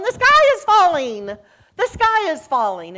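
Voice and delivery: high, loud, frantic squawk of alarm; panicked Chicken Little. Acted. Chicken Little flying out at Jesus, shrieking in panic. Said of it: "Chicken Little comes flying out going".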